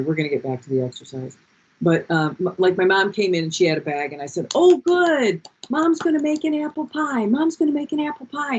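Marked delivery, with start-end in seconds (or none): 4.5-8.6 put-on much higher, eager little-kid voice; a boy sure the bag means pie; excited child. A son imitating his hopeful young self, eager child voice.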